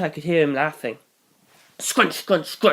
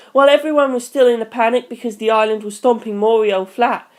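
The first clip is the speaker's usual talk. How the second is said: put-on high, loud boyish voice reporting; urgent teen; 7 semitones higher, 4 dB louder than usual. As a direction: rush out the bad news. Hirose explains urgently.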